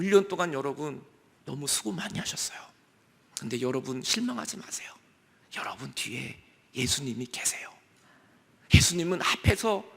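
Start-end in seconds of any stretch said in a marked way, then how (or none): 5.6-7.7 a secret of comfort, breathed; hushed whisper. Real, not acted. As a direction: whisper it softly to the congregation. Preacher drops to whisper. (속삭이듯 조용히)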